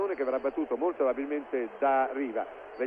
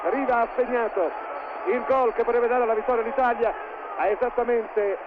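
breathless italian goal commentary; 7 semitones higher, 5 dB louder, slower than usual. Acted. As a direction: build to a loud ecstatic goal cry. Goal call builds and peaks.

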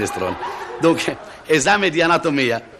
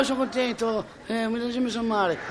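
high but soft, as if drifting away; distant castaway voice receding; fading call; 11 semitones higher, 7 dB quieter than usual. Acted. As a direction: let the happy holler die away, still pitched high. Castaway's shouting dies away.

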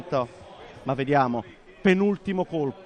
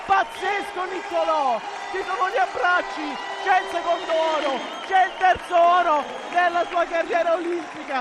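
screaming, much higher ecstatic call; breathless Italian goal commentary; 12 semitones higher, 4 dB louder than usual. Real, not acted. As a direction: yell it like a winning goal. Winning shot, commentator erupts.

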